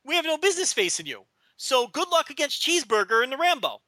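high cocky wrestler promo; wrestling promo. Retold or imitated. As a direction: taunt the challengers smugly. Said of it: "that's what they should have said".

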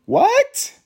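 shocked disbelief on what; incredulous high yelp of what; baffled what. Retold or imitated. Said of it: "I'm like".

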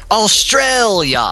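imitating a friend's hammed-up Australian accent; mock Australian accent. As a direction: overdo a bad Australian accent, stretched slowly. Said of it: "claimed he could do a really awesome Australian".

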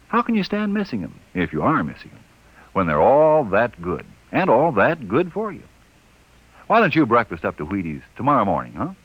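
upbeat radio commercial pitch; ad read. Acted. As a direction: sell the cereal brightly.

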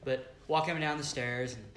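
normal talking voice

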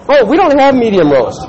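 lightly hammed sweet refusal; chirpy barista. Barista refusing the medium roast, mock sweet. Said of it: "She goes".

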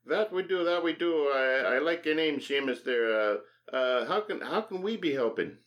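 high Irish leprechaun voice. Pretend. Leprechaun shopkeeper voice.

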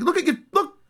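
very high squeaky voice, shouting and laughing; squeaky Puar. Retold or imitated. Puar telling Yamcha his tooth got chipped, squeaky and laughing. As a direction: squeal it out like a tiny flying cat.

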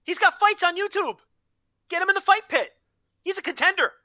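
high, eager hype for the fighter; excited pitch for the fight pit; hyped endorsement. Real, not acted. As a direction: sell him like a promoter. Hyping Bobby Fish.

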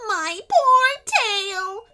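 slow, high, loud wail; sobbing sea serpent. A narrator's reading. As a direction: wail like a big baby. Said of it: "sobbing like a baby".

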